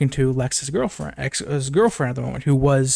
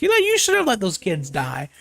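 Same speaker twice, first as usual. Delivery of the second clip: higher mocking dad voice; 5 semitones higher than usual. Mocking Snyder's Jonathan Kent, higher sneering voice. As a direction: sneer at the old version of the father. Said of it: "saying shit like".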